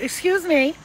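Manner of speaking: high, loud indignant EXCUSE me; sassy excuse me. Shown as a demonstration. Telling bad energy off, loud and sassy. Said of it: "tell that bad energy".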